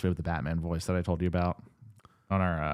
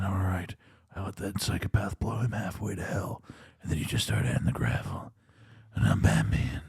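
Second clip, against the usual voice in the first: Cockney voice sinking into much lower Batman growl; Cockney turning into Batman; 4 semitones lower, 6 dB quieter than usual. Shown as a demonstration. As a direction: start Cockney, then drop way down into Batman. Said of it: "talking like this when he's Cockney".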